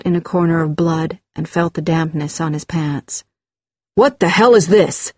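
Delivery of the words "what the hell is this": louder, startled, angry question; irritated outburst; angry demand. Acted. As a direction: demand an explanation forcefully. Angry father finds blood.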